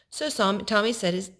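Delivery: plain reading voice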